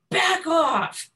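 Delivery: high, loud barked command; retold yell. Narrator yelling at the charging dog to back off. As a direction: snap it at the dog to drive it away. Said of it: "I said".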